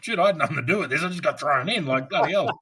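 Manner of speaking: frantic inner monologue; panicked inner thought. Retold or imitated. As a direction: blurt it out in a rush of alarm. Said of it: "I didn't say that. I'm thinking it".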